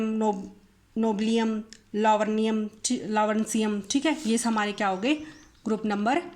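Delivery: usual speaking voice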